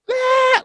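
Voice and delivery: short high-pitched mock scream; imitated scream. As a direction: imitate a street screamer's shriek. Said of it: "screaming on the corner".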